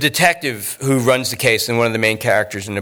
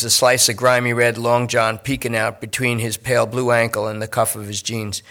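ordinary delivery

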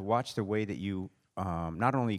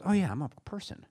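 sudden realization; 9 semitones higher than usual. Retold or imitated. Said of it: "suddenly remembers himself and goes".

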